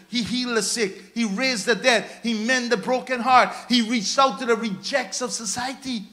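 ordinary talk, nothing marked

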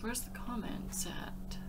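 muttering to herself, unvoiced; whispering. Real, not acted. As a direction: whisper under her breath. Searching for comments, under breath.

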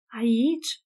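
mildly horrified, rising question. A narrator's reading. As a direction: ask in understated horror. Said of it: "Vocea Dianei era îngrozită". (întrebare ușor îngrozită)

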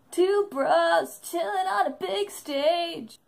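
mock title announcement. Vlogger quotes the duo's joke show title, hammed-up announcement. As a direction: announce it like a silly show name.

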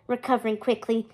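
ordinary delivery, nothing marked

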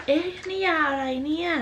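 mock curious "huh?"; puzzled. Acts out finding unknown medicine.

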